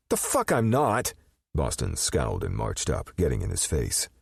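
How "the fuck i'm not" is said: angry retort. A narrator's reading. Boston retorts angrily.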